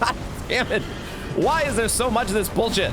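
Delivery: high-pitched frustrated yelling; angry outburst. Real, not acted. Gamer cursing the boss's ghost flame, furious. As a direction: rant at the game in real fury. Raging at game boss.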